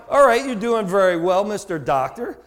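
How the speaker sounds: ordinary talk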